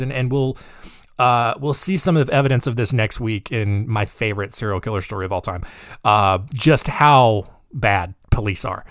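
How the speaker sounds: ordinary delivery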